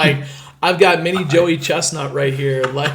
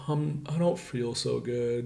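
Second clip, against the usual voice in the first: put-on softer and slow, a queasy little boy; sick kid; 3 semitones lower, 11 dB quieter, slower than usual. Ten-year-old at tryouts telling the coach, softly and slowly. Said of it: "He's like".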